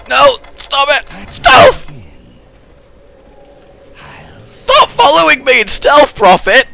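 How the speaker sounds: high, loud protest; shouting. Real, not acted. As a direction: yell it in frustration. Yelling at teammate in game.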